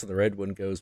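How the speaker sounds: normal talking voice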